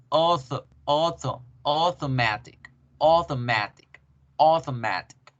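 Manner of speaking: "auto" as "oto", then "automatic" built up slowly as o-to-MA-tic; teacher modelling "automatic" in English for the class; slow pronunciation drill. Shown as a demonstration. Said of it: "van a decir".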